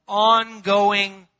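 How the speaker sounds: ongoing stretched very slow and loud; drawn-out word. Acted. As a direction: stretch ongoing out like it never ends.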